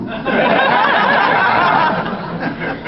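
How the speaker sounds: very high laugh; high bursting laugh. Real, not acted. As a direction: crack up. Laughs at own story.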